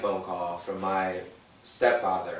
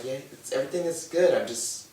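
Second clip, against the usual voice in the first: higher, brushing it off lightly; breezy reassurance; 4 semitones higher, 4 dB louder than usual. Retold or imitated. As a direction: wave off their worry. Own reply to worried family.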